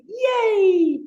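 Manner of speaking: long, high squealed yay; childlike cheer. Shown as a demonstration. Teacher showing playful activity to class, childlike cheer. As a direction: cheer it out, silly and drawn out. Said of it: "A little bit childlike, silly, and fun".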